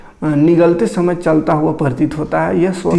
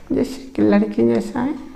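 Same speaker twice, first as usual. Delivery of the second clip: much higher, softer, thin demo voice; thin girlish voice; 7 semitones higher, 4 dB quieter than usual. Shown as a demonstration. Teacher shows students a thin, high girl-like voice. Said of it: "धीरे-धीरे अगर पतला कर रहे हैं तो पतला हो जाएगा".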